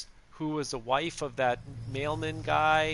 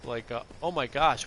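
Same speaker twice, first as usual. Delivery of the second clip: ordinary talk, nothing marked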